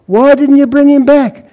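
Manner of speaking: high, sharp incredulous question; exasperated demand. Acted. Police sergeant to constable, high exasperated demand. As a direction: ask as a fed-up boss.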